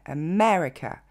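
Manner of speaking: America à l'anglaise, deuxième syllabe appuyée; accent sur ME. Shown as a demonstration. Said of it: "le vrai accent tonique va tomber sur le mais". (America : e-ME-re-que)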